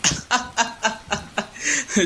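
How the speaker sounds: a soft amused laugh. Acted. Raghab laughs at Sunil who fell in the mud, amused. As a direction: chuckle at his muddy cousin.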